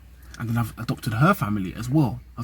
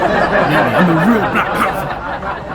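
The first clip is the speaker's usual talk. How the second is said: louder and higher, laughing through it, slowed down; 7 semitones higher, 7 dB louder, much slower than usual. Real, not acted. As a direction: crack up at your own joke. Joke about Ukunda being Wakanda.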